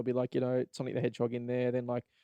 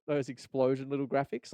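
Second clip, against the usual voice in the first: usual speaking voice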